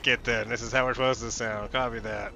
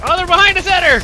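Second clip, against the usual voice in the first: high, loud alarm call; panicked warning shout; 11 dB louder than usual. Real, not acted. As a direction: shout the warning to your teammate.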